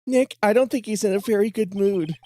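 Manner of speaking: high worried sidekick voice; Maya voice. Acted. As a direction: pitch it up as the worried assistant. Voicing Maya in game.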